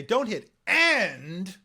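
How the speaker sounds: very slow, each word drawn out and pressed; coach's slow instruction. Retold or imitated. Voice coach telling her student, slowly and firmly, which word to skip. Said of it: "she would say".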